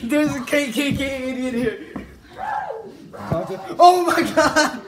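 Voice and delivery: high, loud laughing yell; laughing shout. Real, not acted.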